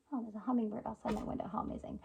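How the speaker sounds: soft breathy whisper of wonder; whispered aside. Real, not acted. Spotting a hummingbird at her window, a hushed whisper. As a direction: hush it as if not to scare the bird.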